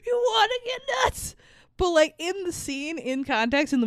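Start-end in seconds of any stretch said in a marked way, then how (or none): 0.0-1.4 mock scream. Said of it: "screaming about".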